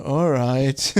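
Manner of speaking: a light take on a fed-up ship computer; lightly voiced disgruntled computer; grumpy computer voice. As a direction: say it like a machine that's sick of you. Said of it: "And he's just".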